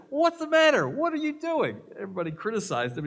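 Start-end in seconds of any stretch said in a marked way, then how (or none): 0.1-1.8 high-pitched, loud, startled cry of dismay; 1 shocked outcry. Retold or imitated. Coworkers catch the dieter with cake, high startled outcry.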